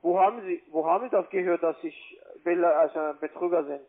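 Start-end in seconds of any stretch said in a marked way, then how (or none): none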